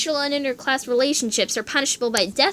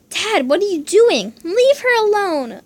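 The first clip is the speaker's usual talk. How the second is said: loud, high, slow begging; pleading shout at his dad; desperate plea; 8 semitones higher, 6 dB louder, slower than usual. Acted. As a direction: cry out to stop him. Pleading with father.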